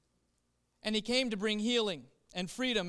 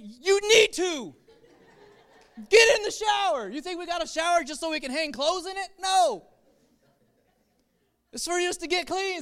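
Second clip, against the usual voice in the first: higher and louder, a near shout at "Get in the shower"; hammed-up dad ordering a child to shower; exasperated dad; 9 semitones higher, 8 dB louder than usual. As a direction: bark the obvious at a silly question. Dad answering about the shower.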